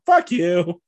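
loud mock shout from a movie; hammed-up defiant outburst; imitated defiant retort. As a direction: quote the line as a punchy yell. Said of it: "just going".

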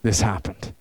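low, fading, a touch of tearful; hint of a distraught girl; mild imitated crying. Counselor retelling a girl crying after a mistake. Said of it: "And they're crying".

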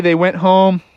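ordinary delivery

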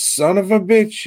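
loud, high mock Pennsylvania exclamation; bad Pennsylvania accent. Imitating a recurring actor in the movies, bad Pennsylvania accent. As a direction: blurt it out in a hammed-up Pennsylvania accent. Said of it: "with a shitty Pennsylvania accent saying".

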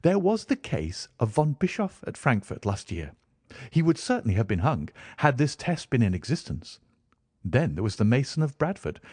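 brisk detective reeling off cases; Sherlock Holmes voice. Acted.

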